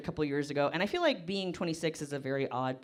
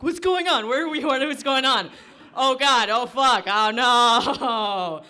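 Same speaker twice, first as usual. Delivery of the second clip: high, loud panicked yelling; panicked waking; 7 semitones higher, 10 dB louder, slower than usual. Retold or imitated. Comic acting out waking confused in leaves, loud panicked yelling. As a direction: wake up in a total panic. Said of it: "I wake up ... and I'm like".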